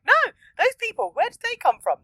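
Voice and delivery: put-on high, loud frightened child; mock frightened shout. Young Ryota shouting at the hooded figures, frightened. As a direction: cry out like a scared boy. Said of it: "he's like".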